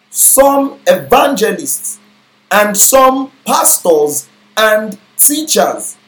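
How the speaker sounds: slow, measured Bible reading; scripture reading. Acted. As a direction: read each gift out slowly and deliberately. Reads scripture slowly.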